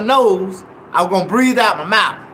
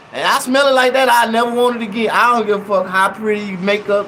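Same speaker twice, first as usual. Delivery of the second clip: ordinary talk, nothing marked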